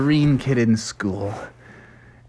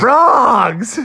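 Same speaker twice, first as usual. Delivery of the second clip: repeated shout; 9 semitones higher, 4 dB louder than usual. Acted. Teller repeats answer loudly.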